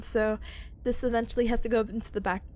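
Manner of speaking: ordinary talk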